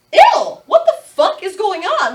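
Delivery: loud, high-pitched disgusted outburst; disgusted shock. Retold or imitated. Woman retelling her shock at date's surprise, high disgusted outburst. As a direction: recoil in loud, horrified disbelief.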